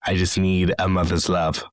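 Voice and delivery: ordinary delivery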